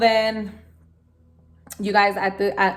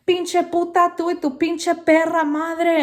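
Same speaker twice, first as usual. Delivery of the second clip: imitating angry neighbor; 8 semitones higher than usual. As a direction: spit it out like the raging neighbor. Neighbor insults mother, voiced.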